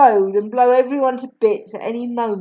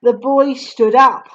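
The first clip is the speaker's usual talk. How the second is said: ordinary delivery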